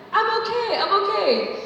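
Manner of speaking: brushing them off with a bright fake fine; forced cheery reassurance. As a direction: squeak out that you're fine. Brushing off friends again.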